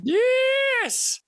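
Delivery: very high, excited yes; excited squeal. Real, not acted. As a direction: squeal it with delight. Excited at Buttercup idea.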